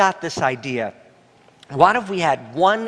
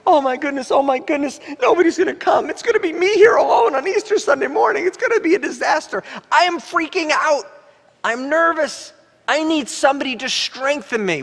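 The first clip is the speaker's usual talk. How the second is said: spiral into comic panic, faster as it goes; retold panic; 10 semitones higher than usual. Pastor replaying his Easter-eve fears, high anxious panic. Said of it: "and I'm thinking".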